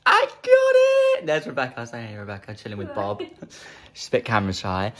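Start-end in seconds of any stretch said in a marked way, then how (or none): 0.1-1.3 very high, slow cry of joy; excited squeal. Real, not acted. Announcing to viewers she got the camera. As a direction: squeal over the new camera.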